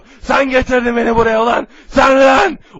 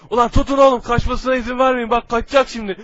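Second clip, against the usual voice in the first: normal talking voice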